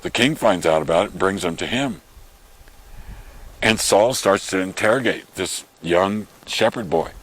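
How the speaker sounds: usual speaking voice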